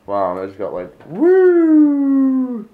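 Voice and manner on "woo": sudden high loud woo; excited whoop. Real, not acted. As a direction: whoop from the head rush. Reacting to pepper rush.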